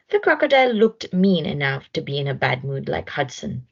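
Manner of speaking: ordinary delivery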